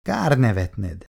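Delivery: usual speaking voice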